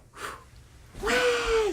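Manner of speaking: put-on high, thin baby wail; imitated newborn cry. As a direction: mimic a newborn's first cry. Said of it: "She starts crying".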